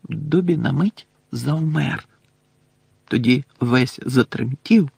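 ordinary delivery, nothing marked

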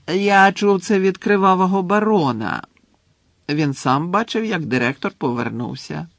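a hint of a chatty ghost, lifted voice; lightly voiced ghost. Ghost tells Harry the headmaster is back, lightly voiced. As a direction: pass on the news as an airy character.

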